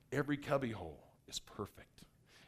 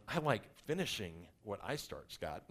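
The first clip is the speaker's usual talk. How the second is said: imitating his wife; 5 semitones lower, 5 dB quieter than usual. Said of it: "That's my wife looking at me going".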